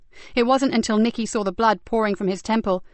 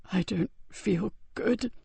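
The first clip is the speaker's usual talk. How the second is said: faint, slow, fading; barely able to speak; weak frightened whisper; 7 dB quieter, slower than usual. Acted. Dying Joseph afraid.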